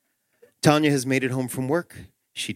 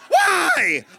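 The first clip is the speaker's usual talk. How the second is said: high-pitched mock child's whine; pestering little kid; 5 dB louder than usual. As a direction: squeal it like a nagging three-year-old. Three-year-old keeps asking why.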